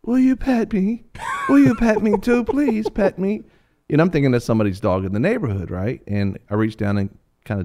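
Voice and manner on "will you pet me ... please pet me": high, slow begging puppy voice; pleading little dog. Pretend. A little dog begging the speaker to pet it, high and slow. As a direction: beg sweetly like a tiny dog. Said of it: "this other little dog comes up and goes".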